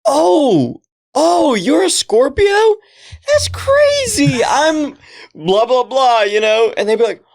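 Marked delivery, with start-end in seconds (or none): 0.1-6.2 high, gushing mock excitement; fake-amazed flirting to win her over; flirty fake gushing.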